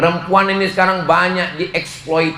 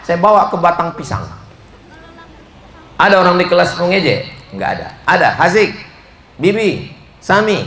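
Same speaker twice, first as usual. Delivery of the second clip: normal talking voice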